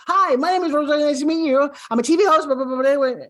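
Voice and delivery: fast, loud, tumbling intro, name swallowed; rushed name intro. Shown as a demonstration. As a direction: rattle it off so the name gets lost. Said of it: "let me show you what it sounds like".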